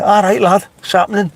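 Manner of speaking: high, lively Scouse quote of all right lad; Scouse greeting. Retold or imitated. Retelling a local's greeting through the car window, Scouse. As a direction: mimic a cheery Liverpool local. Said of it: "I thought I'd open the window".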